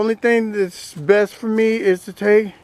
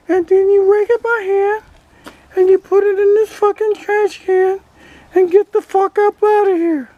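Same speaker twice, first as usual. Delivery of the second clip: higher, cheeky toy duck voice; duck puppet voice; 9 semitones higher than usual. Acted. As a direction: voice the toy duck answering back. Ducky keeps giving orders.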